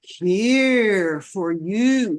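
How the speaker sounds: very slow, hammed-up grand offering; mock big deal. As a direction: overplay the gift like a ceremony. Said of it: "you don't make a big deal out of".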